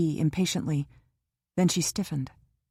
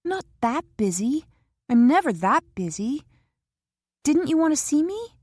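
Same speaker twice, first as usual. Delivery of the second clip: put-on higher, pleading young woman's voice; young Kara, hurt; 6 semitones higher, 3 dB louder than usual. A narrator's reading. Kara asks Jock why he stayed away, hurt and higher. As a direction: push him, wounded and eager. Kara's voice in the novel.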